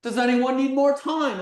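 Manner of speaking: loud call to the whole room. Shown as a demonstration. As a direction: project it to the back row.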